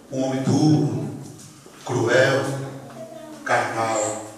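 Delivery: very low, halting prayer of repentance; retold tearful plea. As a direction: beg forgiveness slowly, with long pauses. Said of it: "eu comecei a chorar e gritar".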